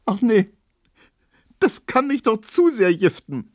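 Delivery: high, loud tearful wail; sobbing. A narrator's reading. Pauline is vexed by the slander, still sobbing. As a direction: lament it in upset bursts. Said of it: "Pauline schluchzte weiter".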